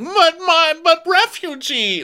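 very high and loud, refugee slow; mock Diana. Podcaster mocking Diana's excuse, very high and loud. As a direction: whine it out. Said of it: "every time you get caught saying".

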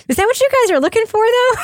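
high, quick doubtful question; mock incredulous. Host retelling a reaction to the movie factory guy, incredulous.

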